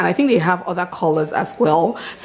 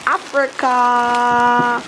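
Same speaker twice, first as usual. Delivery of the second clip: drawn-out excitement; 4 semitones higher, much slower than usual. Real, not acted. Drawn-out word after Accra.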